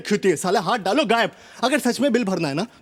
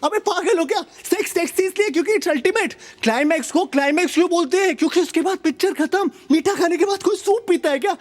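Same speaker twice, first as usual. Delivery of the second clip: higher, laughing disbelief; exasperated outburst; 6 semitones higher than usual. Acted. Comic telling the crowd, high-pitched outraged disbelief. Outraged rant about after-sex talk.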